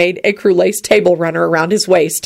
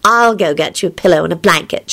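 ordinary delivery, nothing marked

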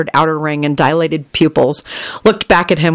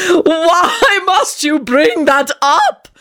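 very high, very slow wailing screech; piteous screech; 10 semitones higher, much slower than usual. A narrator's reading. Friend wailing at teasing friends, high piteous screech. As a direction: screech it like a wounded martyr. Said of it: "Tony screeched piteously".

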